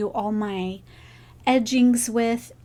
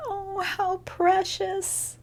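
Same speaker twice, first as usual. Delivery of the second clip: tender coo; 8 semitones higher, 4 dB quieter, slower than usual. Real, not acted. Admiring gift on camera.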